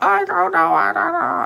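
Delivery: high-pitched imitation of a wailing baby; mock baby cries, garbled and high; Jessica crying. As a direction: imitate a fussing infant's wail. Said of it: "crying and screaming".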